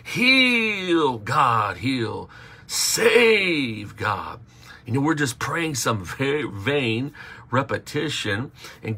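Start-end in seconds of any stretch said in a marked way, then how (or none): 0.0-4.4 imitating a loud, high, repetitive pleading prayer; overdone fervent pleading, mocking; mock prayer-meeting wail. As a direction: ham up a prayer-meeting cry, louder and higher. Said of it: "Maybe you might be".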